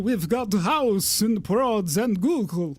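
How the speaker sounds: slow booming host voice; announcer voice. Acted. Host opening the podcast in a slow announcer voice. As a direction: draw it out like a TV host.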